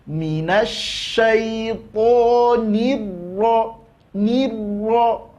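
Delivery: ro with the doubled r pressed; stressed ro. Shown as a demonstration. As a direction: lean on the ro. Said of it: "Nah, itu". (ro tasydid ditekan)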